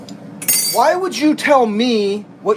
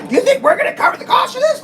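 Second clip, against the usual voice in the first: much higher, squeaky mocking clerk voice; 4 dB louder than usual. Customer ridiculing the assistant manager in a squeaky high voice. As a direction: ape her in a silly high squeak.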